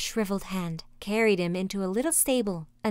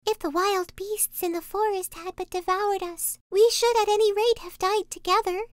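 put-on very high, plaintive little-girl voice; Gretel lamenting; 11 semitones higher than usual. A narrator's reading. Gretel weeps as she fetches water, high lamenting child voice. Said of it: "she cried".

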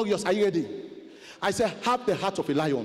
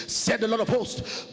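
ordinary talk

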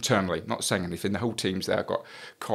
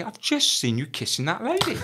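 exasperated high accusation; 13 semitones higher than usual. Retold or imitated. Confronting his boss.

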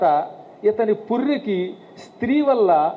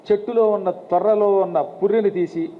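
usual speaking voice

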